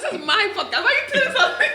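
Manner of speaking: high laughing protest. Real, not acted.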